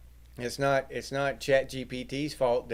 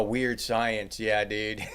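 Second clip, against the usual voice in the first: ordinary talk, nothing marked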